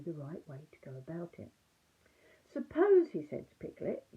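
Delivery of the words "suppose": much higher, louder burst; an eager, excited Suppose; sudden idea. Acted. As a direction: leap on a bright new idea. Pooh voiced in story.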